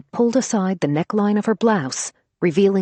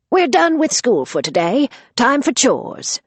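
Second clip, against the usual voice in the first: stern grandmother; 8 semitones higher, 4 dB louder than usual. Acted. Grandmother announces chores briskly.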